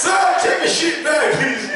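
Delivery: high, loud mock-polite demand; mock polite shout. To the boss, handing the job back, loud and high.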